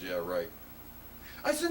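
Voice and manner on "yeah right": lower, dry guard reply; sceptical guard. Retold or imitated. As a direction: dismiss him like a bored guard. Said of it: "He goes".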